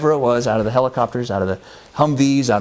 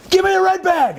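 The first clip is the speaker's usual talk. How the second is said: shouted order; 4 dB louder than usual. Retold or imitated.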